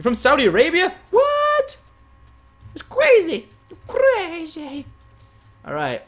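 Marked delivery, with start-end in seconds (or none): 0.0-1.8 loud, high-pitched disbelief; amazed shout. Real, not acted. Streamer learns a viewer is from Saudi Arabia, amazed shout. 2.7-4.9 very high, stretched-out astonishment; amazed squeal. Real, not acted. Streamer marvelling at a far-off viewer, amazed squeal.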